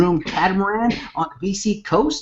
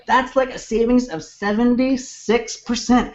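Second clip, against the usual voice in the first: said weird; 7 semitones higher than usual. Real, not acted. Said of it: "I said that weird".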